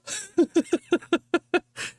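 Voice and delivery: long high laugh; laughing at the audacity. Real, not acted. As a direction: break into laughter. Laughs at Bethesda email.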